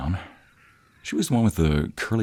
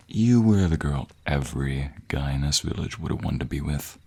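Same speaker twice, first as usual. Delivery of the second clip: ordinary delivery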